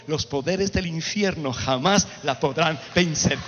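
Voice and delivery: slow, weighty, low proclamation; solemn declaration. Real, not acted. Preacher declaring Christ's promise to his people, solemn and slow. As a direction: lay each word down slowly and firmly.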